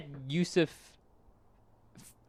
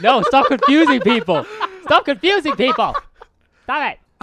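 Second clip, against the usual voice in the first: laughing, louder and higher, telling him to stop; laughing protest; 9 semitones higher, 6 dB louder than usual. Real, not acted. Friend laughing at the fake introduction, loud laughing protest. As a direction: protest through laughter at the joke. Friend jokingly faking names.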